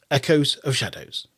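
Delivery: normal talking voice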